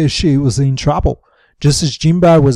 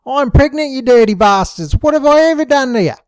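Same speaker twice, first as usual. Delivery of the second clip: high, raised rant; 7 semitones higher, 3 dB louder than usual. A narrator's reading. Sheila ranting at Rabbit and Pat, high and angry. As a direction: rail at them. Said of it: "raving and ranting".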